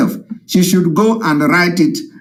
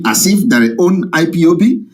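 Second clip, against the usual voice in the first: ordinary delivery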